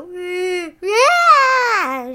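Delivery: a high, loud hammed-up sob; mock crying wail. Shown as a demonstration. Girl demonstrating how to cry it out, mock wail. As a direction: wail like a crying kid, played up. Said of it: "Just go".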